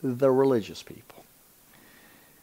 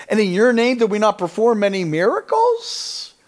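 high, loud, building self-justification; hammed-up insistent believer; mock pleading; 13 semitones higher, 7 dB louder than usual. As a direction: plead your record, rising higher and slowing at the end. Acts religious people pleading at judgment.

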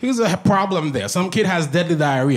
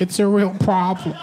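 mildly plaintive insistence; lightly acted wounded protest; mock American complainer. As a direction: insist like a hurt first-worlder. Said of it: "what an American problem is".

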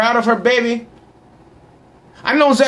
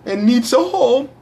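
ordinary talk, nothing marked

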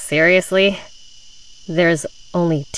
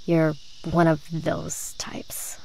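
slow breathy whisper; hushed insinuation; 5 dB quieter, slower than usual. Acted. Girl hinting at Holly's reasons, slow whisper. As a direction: let the hint hang in a whisper.

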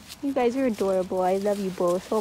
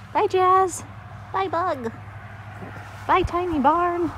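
cheery goodbye; 8 semitones higher than usual. Real, not acted. Calling goodbye leaving barn.

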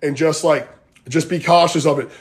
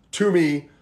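normal talking voice

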